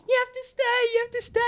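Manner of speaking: high, urgent pleading; pleading cousin voice. Retold or imitated. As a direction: beg him to stay. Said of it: "she's like".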